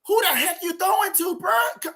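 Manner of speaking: high, indignant yell at the quarterback; exasperated shout. Real, not acted. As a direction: shout it like he can't believe the throw. Yelling at quarterback after miss.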